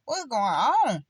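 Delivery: high, loud, exasperated talk to God; casual exasperated prayer. Retold or imitated. Complaining to the Lord about people, high and loud exasperation. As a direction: talk to God like a frustrated friend. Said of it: "I be like".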